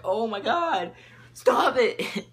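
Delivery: loud, high-pitched retold outburst; mock freak-out. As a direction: cry out in hammed-up disbelief. Retelling an exasperated reaction to alien.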